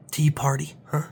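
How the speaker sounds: usual speaking voice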